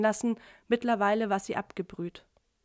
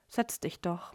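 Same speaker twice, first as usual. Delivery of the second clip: softer, mumbled invitation; murmured; 3 semitones lower, 7 dB quieter, slower than usual. A narrator's reading. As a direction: mumble it, half to herself. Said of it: "murmelte Franka". (leise gemurmelt)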